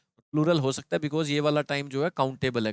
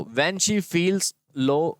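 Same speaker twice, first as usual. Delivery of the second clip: slow reading aloud; 2 semitones higher, much slower than usual. Shown as a demonstration. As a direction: read the sentence out slowly. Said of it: "देखते ही करने वाला error".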